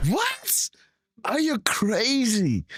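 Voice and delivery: a sudden high squeal of disbelief, then a slow stunned question; shocked disbelief. Real, not acted. Viewer stunned by a reporter's question, high shocked squeal. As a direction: gasp it out like you can't believe what you just heard. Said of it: "streamer's own outburst at the question".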